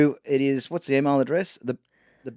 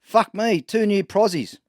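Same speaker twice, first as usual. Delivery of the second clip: pitched-up parrot punchline; 8 semitones higher, slower than usual. Acted. Parrot reacting to the daughters, lightly put-on high voice. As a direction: lightly play a joke parrot, voice raised. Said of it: "parrot says".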